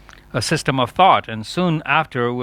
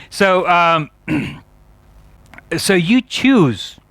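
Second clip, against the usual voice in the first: usual speaking voice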